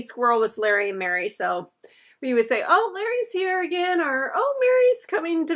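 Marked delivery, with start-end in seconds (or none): none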